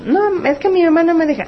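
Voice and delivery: put-on high little-girl voice, politely refusing; a small child backing off, pitched high; hesitant child. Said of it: "Y tú le dices".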